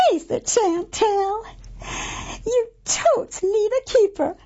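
high, bright teasing friend voice; Gertie voice. A narrator's reading. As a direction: tease her like a bubbly know-it-all pal.

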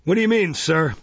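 loud, high demand; startled challenge. A narrator's reading. Beadle questioning the dying child, startled and stern. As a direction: challenge him as an affronted official.

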